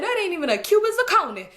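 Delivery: high mocking scoff; scoffing uncle. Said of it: "He said".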